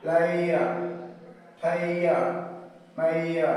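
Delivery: লেয়া and মাইয়া with a stretched aɪə glide; example words, emphatic. Shown as a demonstration. (উদাহরণ শব্দ জোর দিয়ে)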